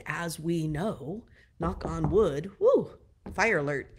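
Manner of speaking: usual speaking voice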